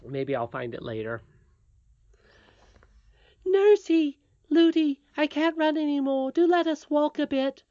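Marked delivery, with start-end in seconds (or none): 3.4-7.6 put-on high, loud child's plea; pleading princess. A narrator's reading. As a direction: beg as a worn-out little princess. Princess begs nurse to stop running.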